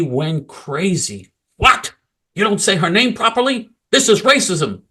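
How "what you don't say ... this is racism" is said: mock outrage. Mocking the outraged panel.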